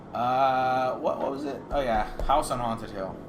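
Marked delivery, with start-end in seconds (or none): none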